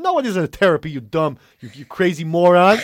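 put-on exasperated New York accent, slowing on the insult; intense New Yorker. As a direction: imitate an intense New Yorker telling someone off. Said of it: "he's very intense".

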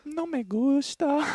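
high, slow mock Mexican accent. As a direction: play it up as a broad Mexican accent. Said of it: "He's like".

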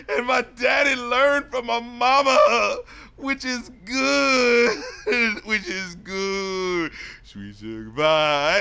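slow, high, wailing sob-singing parody; mock crying. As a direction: blubber the words out like a drunk crooner. Imitating rapper crying on track.

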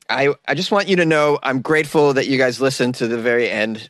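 ordinary talk, nothing marked

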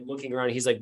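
usual speaking voice

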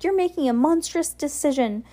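put-on high-pitched child's warning; crying out. A narrator's reading. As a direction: warn her in a high, pleading voice. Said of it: "Sunny cried".

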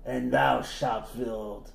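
slow, low archaic threat; viking warrior voice. Pretend. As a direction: menace him with old-world gravity. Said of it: "we're just doing Skyrim".

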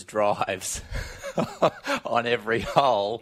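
laughing, half shouted. Real, not acted. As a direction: burst out laughing mid-sentence. Golfer laughing, half shouting the story.

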